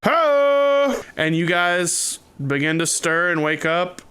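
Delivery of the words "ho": long, high "ho"; high sustained driver's call. Acted. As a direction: holler one drawn-out syllable. Driver calling out on arrival.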